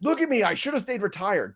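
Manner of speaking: high whiny complaining quarterback; Tom Brady voice. Pretend. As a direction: whine it like a fed-up veteran. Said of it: "That's what Tom Brady's saying to himself".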